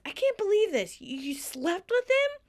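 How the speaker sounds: indignant. Retold or imitated.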